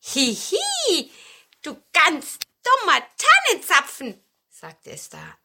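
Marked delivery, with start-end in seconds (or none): 0.1-4.2 high, loud little voice making fun; giggle, then tease in a piping voice; teasing giggle. A narrator's reading. Hidden cone teases the newcomer. (hohes, freches Necken)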